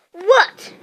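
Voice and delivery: high, loud sharp question; irritated what. Acted. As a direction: turn on him sharply. Harry snaps at Neville.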